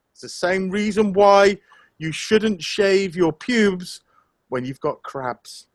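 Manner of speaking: usual speaking voice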